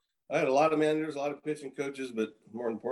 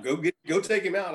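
higher, louder eager push to get into the game; eager plea; 6 semitones higher, 4 dB louder than usual. Retold or imitated. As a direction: beg to be sent in, keyed up. Eager reliever pleads to go in.

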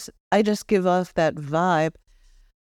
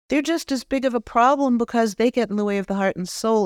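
ordinary talk, nothing marked

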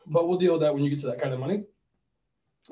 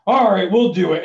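loud, raised, fed-up giving in; exasperated, higher and louder mock concession; imitated angry agent; 5 semitones higher, 7 dB louder than usual. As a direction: mimic an annoyed clerk caving in. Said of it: "she's got all angry".

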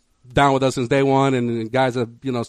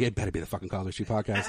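low, hushed aside to himself; inner thought; 6 semitones lower, 14 dB quieter, faster than usual. Retold or imitated. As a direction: mutter it privately, much softer and lower. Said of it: "in my mind, I'm like".

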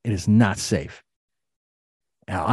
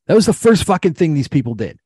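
higher and louder, furious emphasis; angry outburst; 9 semitones higher, 6 dB louder than usual. Real, not acted. Ranting to the host about the rail company, an angry outburst. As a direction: spit out the words in real anger.

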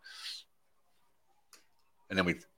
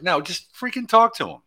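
exasperated; 12 semitones higher, 6 dB louder than usual. Real, not acted. Exasperated advice to host.

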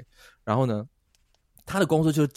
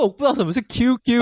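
put-on high, louder baffled young tourist; a tourist caught out, higher and louder; clueless surprise; 11 semitones higher, 6 dB louder than usual. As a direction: blurt it out confused. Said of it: "我就说".

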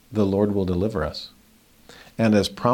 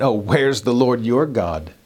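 mocking. Scoffers taunting the fallen believer, mocking. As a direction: put on a scornful jeer. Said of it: "Those that were mocking you".

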